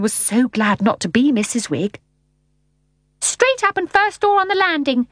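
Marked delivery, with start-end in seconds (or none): 3.2-5.1 very high, thin voice; piping, put-out lady giving directions; thin-voiced lady. A narrator's reading. Miss Persimmon directing visitors upstairs, thin high voice. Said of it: "said Miss Persimmon".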